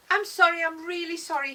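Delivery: put-on flustered, loud apology in a French accent. A narrator's reading. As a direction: apologise urgently with a high-pitched French accent. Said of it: "sounds French, like my French teacher".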